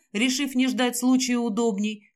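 usual speaking voice

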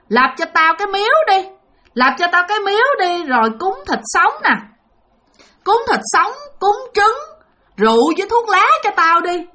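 high-pitched ghost giving orders; imitated possessing spirit, threatening; demanding spirit. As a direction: dictate offerings in a high, insistent spirit voice. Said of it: "ba của bạn trả lời vậy".

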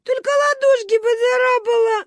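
put-on high-pitched tearful child's whine; crying little girl; sobbing. A narrator's reading. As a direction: blubber it out in a high wail. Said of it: "рыдала Маня".

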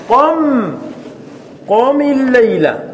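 قم الليلة drawn out slowly; slow chanted قم الليلة; slow drawn-out reading. Shown as a demonstration. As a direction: stretch قم الليلة like a recitation. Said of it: "قم الليلة، لكن نقرأها قم الليلة".

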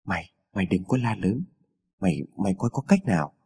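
low, hushed and stammering. A narrator's reading. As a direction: keep it down, softly urging secrecy. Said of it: "hạ thấp giọng trước thuộc hạ của mình".

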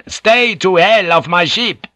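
slow, high, angry shout. A narrator's reading. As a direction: shout it down to the water. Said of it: "He shouted down".

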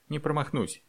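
understated icy firmness. A narrator's reading. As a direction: answer with cold determination. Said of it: "ледяным голосом сказала Алёна".

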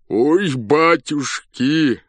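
slow, high, loud wail of pain; a general wailing from toothache. A narrator's reading. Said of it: "вопил он".

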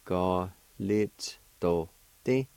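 Ga lit do ti said slowly with each syllable distinct; slow demo. Shown as a demonstration. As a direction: spell out Ga lit do ti slowly for the class.

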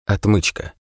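ordinary delivery

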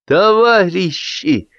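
high, drawn-out "comrades"; calling out a toast. A narrator's reading. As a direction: address the whole table slowly, pitched high. Toast speaker calls out to guests.

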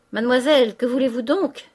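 loud, exasperated landlady's cry; exclaimed protest. A narrator's reading. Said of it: "s'écria madame Vauquier".

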